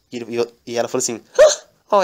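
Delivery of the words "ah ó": high, loud burst of surprise; hammed-up surprised maid; mock surprise. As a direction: jump up in surprise. Daniela reacts to Lorenzo.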